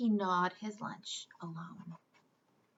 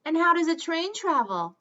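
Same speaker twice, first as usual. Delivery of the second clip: higher, louder mocking question; sly teasing; 7 semitones higher, 9 dB louder than usual. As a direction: set the trap with a wink. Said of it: "They winked at each other".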